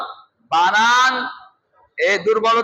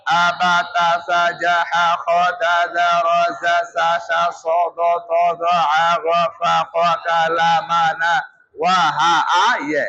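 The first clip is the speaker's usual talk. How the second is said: the letters run together in one breath, lower pitch; 4 semitones lower than usual. Shown as a demonstration. Teacher showing students to say all letters in one breath. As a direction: chant the whole alphabet through without stopping. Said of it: "এভাবে দেখেন".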